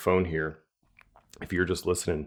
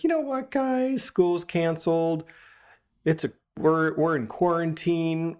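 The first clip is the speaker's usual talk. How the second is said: giving in; 8 semitones higher than usual. Retold or imitated. Dad caving to the kids during quarantine, high-pitched concession. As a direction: talk yourself into it, pitched up.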